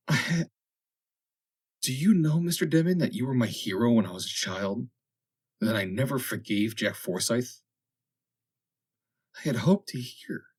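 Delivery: put-on gently confessing an old hurt; young woman. A narrator's reading.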